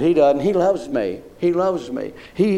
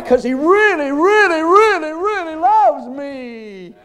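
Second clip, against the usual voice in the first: climbing higher on each really, then slow; building preaching; 11 semitones higher, slower than usual. Acted. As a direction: lift it step by step to the peak. Rising fervent repetition.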